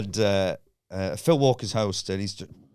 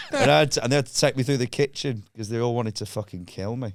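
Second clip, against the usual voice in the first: ordinary talk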